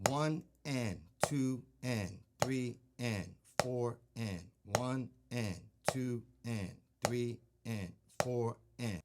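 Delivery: even, metronomic count with "and" on each offbeat; counting eighth notes. Shown as a demonstration. Drum teacher counting eighth notes for a student, steady and rhythmic. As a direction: count "one and, two and" steadily, each word separated. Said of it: "Think of it in eighth notes".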